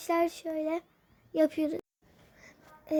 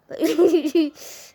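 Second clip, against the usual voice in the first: giggle; 7 dB louder than usual. Real, not acted. Laugh after the lament.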